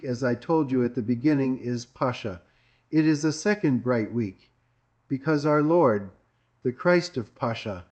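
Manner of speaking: ordinary talk, nothing marked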